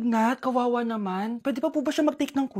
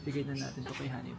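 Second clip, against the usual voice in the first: much softer, hushed so the surprise isn't spoiled; hushed sneaking; 12 semitones lower, 16 dB quieter than usual. Real, not acted. Sneaking up on Honey with the cake, softly hushed.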